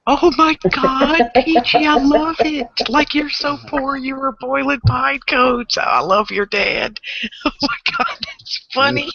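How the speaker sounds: laughing delight. Real, not acted. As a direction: squeal it out through laughter. Hearing the pine cone story.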